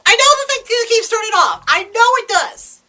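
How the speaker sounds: high, loud, rushed frustrated yelling; exasperated shouting. Acted. Fed up with the television turning off, exasperated shouting. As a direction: blow up and shout your excuses back.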